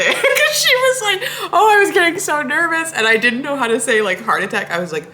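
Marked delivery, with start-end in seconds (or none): 0.0-2.9 high, loud, laughing quote of the driver; laughing retelling. Retold or imitated. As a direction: tell it while cracking up.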